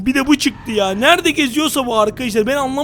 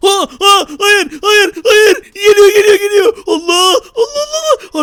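higher, much louder frightened cries; panicked screaming; 6 semitones higher, 7 dB louder than usual. Acted. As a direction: scream in terror as it comes. Villain approaching, panic. (panik içinde çığlık)

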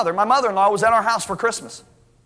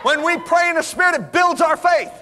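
preaching shout; 6 semitones higher than usual. Acted. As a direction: drive the point home to the crowd. Preaching at full force.

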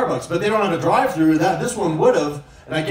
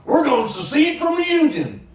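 higher, mock-proud Texan boast; 7 semitones higher than usual. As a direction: parody a smug Texan. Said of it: "the first one is always like".

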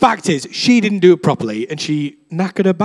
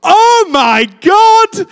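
much higher, louder, drawn-out cry; top of her voice; 13 semitones higher, 6 dB louder, much slower than usual. Retold or imitated. Injured mum cries out as the pain stops, high drawn-out shout. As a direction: let her healed cry ring out slowly. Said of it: "top of her voice".

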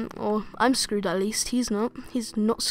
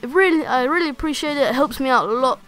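upbeat outro voice; bright sign-off to viewers; YouTuber outro; 4 semitones higher, 7 dB louder than usual. Acted.

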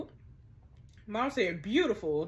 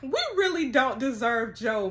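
ordinary talk, nothing marked

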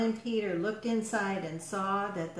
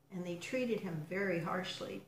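ordinary delivery, nothing marked

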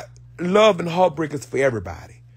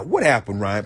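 ordinary talk, nothing marked

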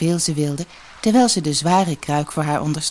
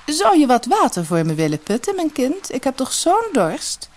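high, refined request for a favour; rich lady pleading; 9 semitones higher than usual. A narrator's reading. Disguised fairy asking a girl for water, pleading. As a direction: ask sweetly in a high lady's voice.

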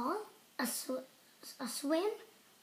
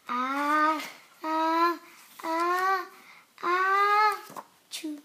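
ah-ah-ah growing louder, then the sneeze; building sneeze; 6 dB louder, much slower than usual. Acted. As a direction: wind up slowly, then sneeze.

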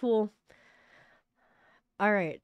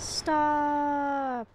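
long, higher-pitched drawn-out plea; desperate cry; 7 semitones higher than usual. Acted. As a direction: cry out, stretching the word. Game character cries out to stop.

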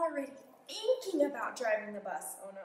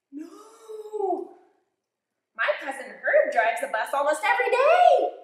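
high, louder pigeon arguing; 9 semitones higher, 10 dB louder, slower than usual. Acted. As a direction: insist like a cartoon bird.

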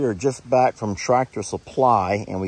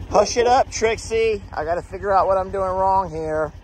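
higher, firm pet scold; hushing the dog; 9 semitones higher than usual. Real, not acted. Owner hushing Trixie while working the harrow, higher firm voice.

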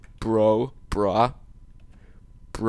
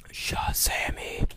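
whisper; 9 dB quieter, slower than usual. Acted. Whispering the magic word.

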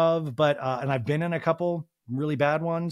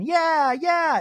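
high, loud whoops from the crowd; cheering yell; 13 semitones higher, 7 dB louder than usual. Retold or imitated. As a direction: cheer her on from the seats. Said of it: "The audience is yelling".

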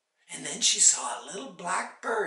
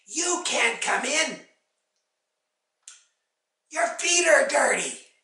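witch's raised, dismissive voice; loud refusal; 8 semitones higher, 8 dB louder than usual. A narrator's reading. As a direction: call the rebuff through the door.

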